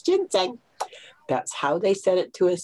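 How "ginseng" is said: Ginseng high and falling, imitating the Chinese way; Chinese-style pronunciation. Shown as a demonstration. Speaker showing how the Chinese said it to us, Ginseng high and falling. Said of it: "say it the way the Chinese said it to us".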